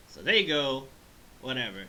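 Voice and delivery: ordinary delivery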